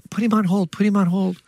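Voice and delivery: high, hushed urging; urgent secretary. Retold or imitated. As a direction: interrupt discreetly with a repeated plea. Secretary urgently interrupts his call.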